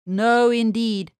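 plain reading voice

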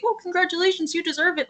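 high, sugary mock-polite congratulations; fake congratulations. People offering hollow praise after the title win, mocked in a high fake voice. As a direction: pitch it way up like empty praise you don't mean. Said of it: "you get the fake".

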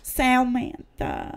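very slow, drawn-out Samantha. Shown as a demonstration. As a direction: stretch Samantha out condescendingly. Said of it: "Should I say it slower for you?".

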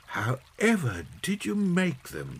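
high-pitched wondering question; children amazed at handmade gifts; marvelling children. A narrator's reading. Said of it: "they asked".

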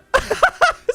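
very high giggling burst; high-pitched cackling giggle; high laugh. Real, not acted. Nervous about jumping in game.

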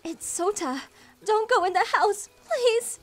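screamed plea, rising in pitch and volume; desperate scream. Acted. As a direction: yell to stop someone walking away. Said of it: "I screamed as loud as possible".

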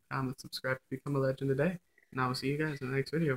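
quick rattled-off outro; vlog outro. Acted. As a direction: rattle off the sign-off. Outro sign-off.

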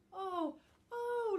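high, loud anguished cry; little Tuft cries out as Book flies off. Acted. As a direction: wail it out in fear. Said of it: "little Tuft cried out".

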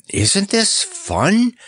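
high, drawn-out excited question; thrilled and high-pitched; delighted cry. Acted. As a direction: cry it out in slow, soaring delight. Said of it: "cried Bob".

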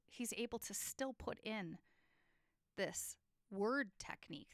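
normal talking voice

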